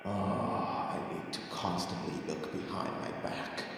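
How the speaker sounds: hushed, worried whisper; nervous whisper. Acted. Player nervously whispering to self.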